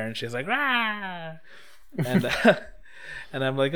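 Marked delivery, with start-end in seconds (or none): 0.5-1.3 high, drawn-out "rah" imitating her; playful battle cry. Girl acting out her stabbing story, playful high cry. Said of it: "she's, like, stabbing there".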